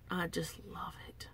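breathy whisper, no voice at all. Real, not acted. Thanking her viewers, whispering the repeat. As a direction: breathe it out as a secret.

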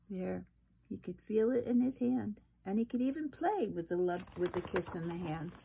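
usual speaking voice

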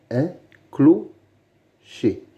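"un clocher" slowly, with gaps between syllables. Shown as a demonstration. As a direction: split "clocher" into two careful beats. Teacher splits word into syllables. (« un clocher » lentement, syllabe par syllabe)

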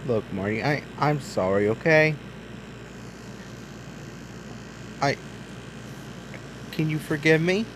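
low, soft, sorry dad voice; sincere apology. Pretend. Dad truck softly asks Marty for forgiveness. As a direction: say sorry gently to your son.

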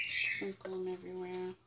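usual speaking voice

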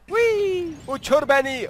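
high, thrilled cry of flight; gleeful whee. Real, not acted. Gamer cheers as his chicken lifts off, high and gleeful.

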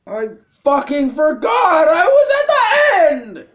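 high, loud wail climbing to a scream; real rage at your own mistake; anguished shout. Real, not acted. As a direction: build to a screamed, near-crying protest. Player furious at own mistake.

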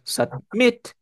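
submit with the second syllable, MIT, stressed; stress on final syllable. Shown as a demonstration. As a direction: hit the end of submit: sub-MIT. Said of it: "si tiene la fuerza de voz al final". (submit con la fuerza de voz en MIT, al final)

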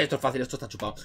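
low and very soft; muttered self-talk. Real, not acted. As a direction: mumble it to yourself. Se lo dice a sí mismo. (murmullo bajo para sí mismo)

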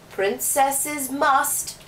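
very slow, drawn-out king; slow stern king. Acted. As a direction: stretch each word sternly. King repeating the rule.

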